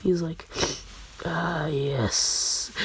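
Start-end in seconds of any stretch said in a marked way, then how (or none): 1.2-2.7 soft, drowsy imitation of a sleep-talker; sleep-talking. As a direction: murmur it low-key, as if dreaming. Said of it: "while in his sleep".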